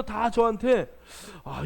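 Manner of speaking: ordinary talk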